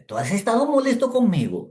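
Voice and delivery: high, loud amazement; suddenly getting it; asking the young man in amazement; astonished question. Retold or imitated. Realizes, asks amazed.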